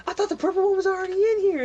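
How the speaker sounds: high, loud, fast confused shout; guard shouting back, baffled; confused guard yelling. Acted. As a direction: rattle it off, yelling and puzzled. Guards shouting across hallway.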